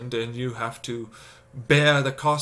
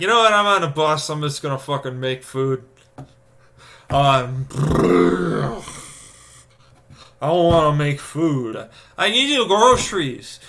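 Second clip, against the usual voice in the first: exasperated rant; 2 semitones higher, 6 dB louder than usual. Retold or imitated. Retelling his tired self to viewers, fed up and loud. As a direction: blow up at the hassle of making food. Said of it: "I've been like".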